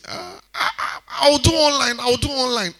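high, hesitant excuse voice, stammering; mock excuse. Pastor mimics Sister Esther dodging church, high and stammering. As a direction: act out a flustered churchgoer's excuse.

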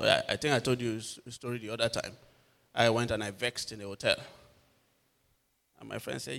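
ordinary delivery

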